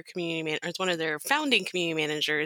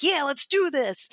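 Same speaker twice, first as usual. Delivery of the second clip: higher, slower rallying cry; eager enthusiasm; 9 semitones higher, slower than usual. Retold or imitated. Guest quoting the founding employees who get community, eager. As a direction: voice keen colleagues jumping right in. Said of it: "they're like".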